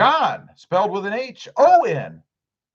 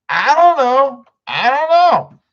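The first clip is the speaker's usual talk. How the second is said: mock clueless; 9 semitones higher, 5 dB louder, slower than usual.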